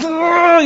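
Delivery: high, shuddering growl of fury; hammed-up trembling groan; imitated rage shudder. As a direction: shake with mock rage, wordlessly. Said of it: "And I was shaking".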